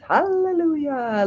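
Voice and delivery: hallelujah sung out high and slow; high, stretched joyful hallelujah; drawn-out hallelujah. Real, not acted. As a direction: belt it like a church praise. Cheering the commenter's remark.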